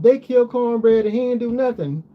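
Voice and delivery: movie quote. Retold or imitated. Quoting a movie line.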